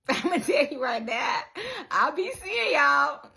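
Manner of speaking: calling out to her followers with delight; excited. Real, not acted. Vlogger hyping up her followers, excited.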